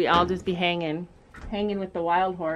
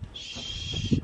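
hushing; 8 dB quieter than usual. Real, not acted. Hushing the horse.

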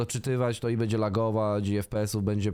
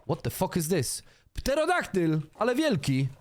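amazed; 8 semitones higher than usual. Real, not acted. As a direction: gasp at the giant beast. Sees giant pterodactyl. (zdumiony okrzyk na widok pterodaktyla)

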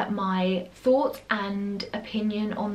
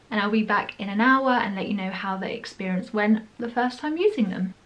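slight lisp from strips; talking with coated teeth; whitening strips on teeth. Real, not acted. Seen 1: Teeth show glossy strip layer while talking; removed later at +22.2.

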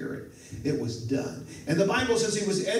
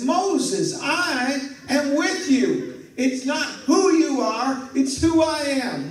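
slow, higher and louder, commanding; voice of God; 9 semitones higher, 5 dB louder, slower than usual. Acted. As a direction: proclaim it like God answering. God reassures Moses.

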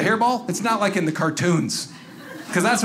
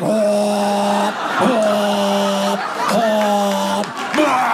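mock cat retching; much slower than usual. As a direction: act out a cat hacking up a hairball. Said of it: "He's just like".

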